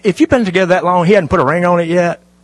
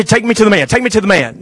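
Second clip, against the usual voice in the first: mock desperate woman; 5 semitones higher, faster than usual. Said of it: "I say".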